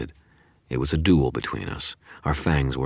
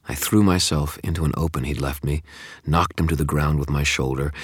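usual speaking voice

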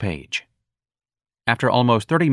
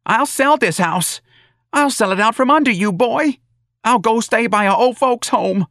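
screaming; 6 dB louder than usual. A narrator's reading. Mrs. Riley screaming at her son about money, furious. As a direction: scream it in fury, stretching it out. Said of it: "Missus Riley screamed".

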